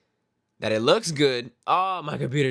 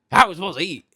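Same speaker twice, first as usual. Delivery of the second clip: mock rude guy, higher and louder; rude customer; 8 semitones higher, 11 dB louder, faster than usual. Server mimicking a rude customer's demand, mock loud. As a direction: imitate a rude diner demanding. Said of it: "He's like".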